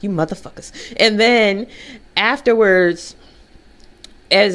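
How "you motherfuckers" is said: much softer, resentful under-breath curse; inner grumble. Retold or imitated. Silently cursing the group that outvoted her, soft resentful mutter. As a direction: mutter the grudge inside your head. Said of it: "but on the inside, I was like".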